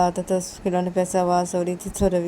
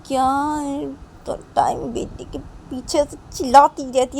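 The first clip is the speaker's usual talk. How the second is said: fed up; 6 semitones higher than usual. Acted. Husband grumbles fed up with wife.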